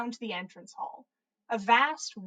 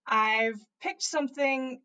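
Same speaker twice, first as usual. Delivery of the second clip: ordinary delivery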